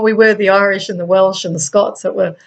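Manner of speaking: normal talking voice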